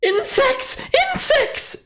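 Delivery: high, shrieky giant alarmed by bugs; panicked giant. Acted.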